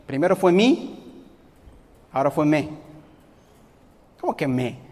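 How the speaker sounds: ordinary talk, nothing marked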